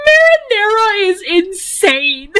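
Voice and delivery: high, slow, through laughter; giggling wheeze; laughing. Real, not acted. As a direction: crack up as you say it. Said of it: "laughing at her nickname".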